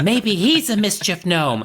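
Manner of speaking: high, slow, goofy gnome musing; imagined gnome voice. Acted. Gnomes puzzling over being licked, high silly voice. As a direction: draw it out in a high mock gnome voice.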